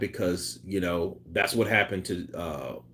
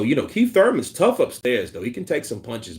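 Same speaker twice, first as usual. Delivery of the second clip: ordinary talk, nothing marked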